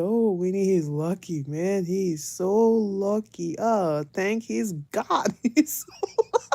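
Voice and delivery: drawn-out slow mock relief; slow teasing. The family teasing Winnie about the man, slow. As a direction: stretch each word out slowly. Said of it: "we said".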